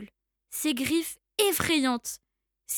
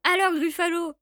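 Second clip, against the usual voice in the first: confident mouse; 3 semitones higher, 3 dB louder than usual. Acted. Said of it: "dit la souris".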